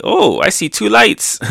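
high-pitched, wondering cartoon voice; Luffy. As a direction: spot it with playful wonder. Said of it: "Luffy seeing".

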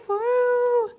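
loud, high woo; excited whoop. Real, not acted. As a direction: whoop it out with joy. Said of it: "Sorry, I probably gave you a headache".

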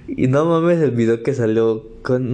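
ordinary delivery

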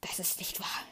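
stunned, breathless whisper; panicked whisper. A narrator's reading. Luke denies Vader's words in a shocked whisper. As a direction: whisper it in disbelief. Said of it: "sagt Luke panisch".

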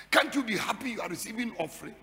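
ordinary talk, nothing marked

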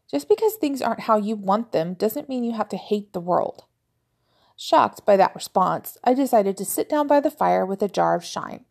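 plain reading voice

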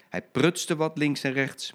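plain reading voice

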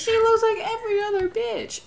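very high mock voice; high-pitched singsong mockery; mocking mimicry. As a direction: squeal it in a put-on high pitch. Mimicking remark about her masked look.

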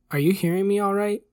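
high, rising check. A narrator's reading. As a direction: pitch the question up to reach failing ears.

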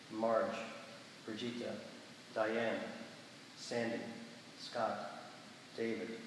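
measured liturgical prayer voice; prayer reading. Acted. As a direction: lead the congregation in solemn prayer. Leads prayers of the people.

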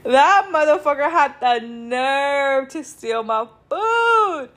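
slow words squeezed out through laughter; can't stop laughing while telling it; laugh-crying at the nerve of it; laughing in disbelief. Real, not acted. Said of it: "I kept laughing, bro".

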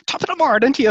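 high, loud cartoon Irish brogue; stage Irish accent. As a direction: mimic a TV caricature of an Irish accent. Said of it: "it always just sounds so kind of".

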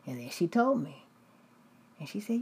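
usual speaking voice

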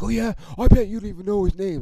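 high, quick taunt; mocking. Rival fan challenged about his jersey, mocking. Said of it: "try to mock me".

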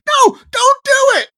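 high, loud desperate shout; crying out. Acted. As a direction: scream to stop it. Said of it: "Lauren cries out".

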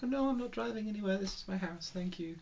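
soft, high mock German accent; muddled put-on German accent. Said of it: "what accent you're working on".

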